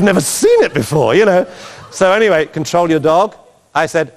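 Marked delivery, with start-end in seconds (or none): 2.5-3.4 "Control your dog" rising at the end like a question; rising intonation. Retold or imitated. Judge to the handler, "Control your dog" with hopeful rising intonation. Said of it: "Rising intonation".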